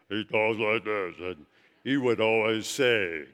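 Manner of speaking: a hint of a deep bass professor; deep-voiced pastor. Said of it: "He had a very deep voice".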